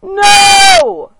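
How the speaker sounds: high, loud horrified shout; terrified no. Pretend. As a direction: cry out in horror. Terrified scream at threat.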